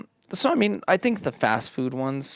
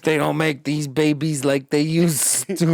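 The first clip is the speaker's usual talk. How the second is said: put-on slow, higher grumbling old-timer; 6 semitones higher, 6 dB louder than usual. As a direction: lament like a grumpy grandpa. Nostalgic mock old-timer line.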